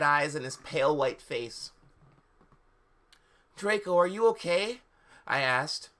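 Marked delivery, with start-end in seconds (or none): 3.6-4.8 high-pitched, worried question rising at the end; concerned heroine. A narrator's reading. Ebony checking on Draco, concerned and high-pitched.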